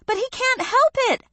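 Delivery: very high, fast pleading; pleading cry. A narrator's reading. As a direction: beg quickly in his defence. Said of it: "Aya cried".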